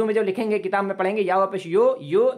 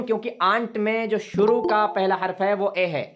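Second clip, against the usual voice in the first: ordinary talk, nothing marked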